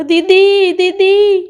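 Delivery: high, plaintive call for big sister; mock-tearful cry for help. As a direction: call out as if crying. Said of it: "तुम क्यों रो रही हो".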